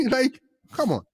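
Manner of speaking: high, loud laughing disbelief. Real, not acted. Laughing at Africa-is-country joke.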